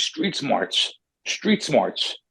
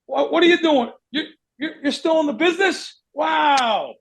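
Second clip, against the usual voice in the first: mock surprise; 8 semitones higher than usual. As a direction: overplay the wow to needle him. Mocking surprise at old rival.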